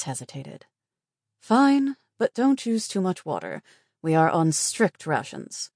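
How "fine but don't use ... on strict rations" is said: grudging consent, high and loud then easing; disapproving. Acted. Reluctant nurse allowing a late shower, disapproving. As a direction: give in grudgingly, then lay down the rule. Her disapproval was evident.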